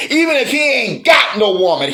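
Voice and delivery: usual speaking voice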